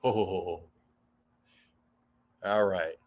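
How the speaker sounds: ordinary talk, nothing marked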